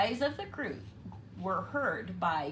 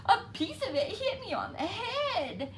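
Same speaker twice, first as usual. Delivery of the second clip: small chick; 13 semitones higher than usual. A narrator's reading. Chick complaining.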